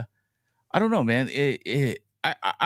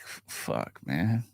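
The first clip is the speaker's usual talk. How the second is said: whispered, drawn-out frustration; half-whispered defeated groan of frustration; muttered curse; 6 semitones lower, 6 dB quieter, much slower than usual. Retold or imitated. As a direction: mutter it under your breath, slowing down. Frustrated self quoted.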